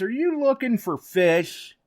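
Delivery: high-pitched imitation of a kid's question; little kid. Kid asking a man out water hunting, little-kid voice. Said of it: "just a little kid".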